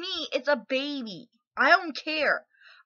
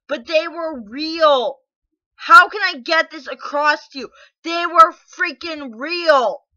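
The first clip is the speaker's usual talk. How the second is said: insisting loudly; 3 semitones higher, 7 dB louder than usual. Real, not acted. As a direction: drive each word home, louder. Insisting babies were real.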